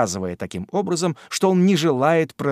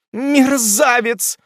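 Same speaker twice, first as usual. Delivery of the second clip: indignant cry; 10 semitones higher, 4 dB louder, much slower than usual. A narrator's reading. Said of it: "воскликнули в один голос".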